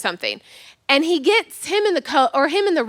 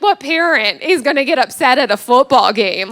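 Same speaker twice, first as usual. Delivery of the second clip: ordinary delivery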